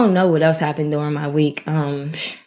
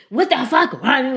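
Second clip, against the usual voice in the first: higher-pitched mock outburst; mock yelling back; 7 semitones higher than usual. Shown as a demonstration. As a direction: act out snapping back at a friend. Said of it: "It's not me saying".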